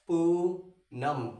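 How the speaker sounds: पूनम stretched very slowly, each sound held; drawn-out name. Shown as a demonstration. Teacher sounding out the name slowly for students. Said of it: "अब देखो".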